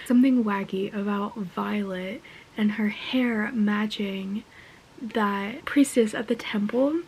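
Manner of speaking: soft hushed whisper; conspiratorial whisper. Real, not acted. Fan whispering her theory to viewers, conspiratorial whisper. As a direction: share the theory like a secret.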